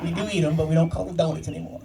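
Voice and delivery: usual speaking voice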